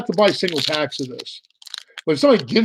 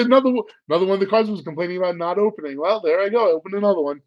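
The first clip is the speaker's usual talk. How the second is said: normal talking voice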